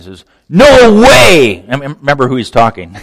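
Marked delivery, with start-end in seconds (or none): 0.5-1.6 loud, high acted refusal; impulsive defiance. Preacher playing Peter refusing Jesus, loud impulsive defiance. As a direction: blurt it out in full defiance. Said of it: "Peter's impulsive defiance".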